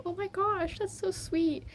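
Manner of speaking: high-pitched, re-enacted melting at sweetness; touched gushing. Moved by little sister's forgiveness, high retold gushing. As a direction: coo it high, imitating being touched.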